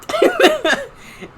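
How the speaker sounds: high, loud laugh; burst of laughter. Real, not acted. Friend cracking up at his parents' story, loud laughter.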